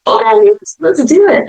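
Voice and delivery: high, eager retold reply; playfully game for it; lightly giddy, climbing in pitch; giddy agreement. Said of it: "giggling and laughing".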